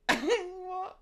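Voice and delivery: high, loud "What?"; shocked shriek. Retold or imitated. Girl hearing the ghost claim, shrieked shock. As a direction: squeal it in disbelief. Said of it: "I was like".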